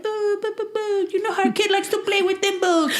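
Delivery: high-pitched mock-flustered babble; flustered excuse. Caught planning a jailbreak with thimbles, comically flustered. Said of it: "And he's all like".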